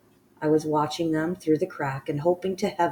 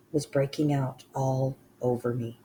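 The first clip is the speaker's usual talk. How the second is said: usual speaking voice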